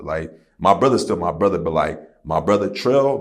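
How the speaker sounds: usual speaking voice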